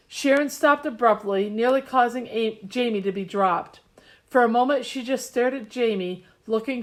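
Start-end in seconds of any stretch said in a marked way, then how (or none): none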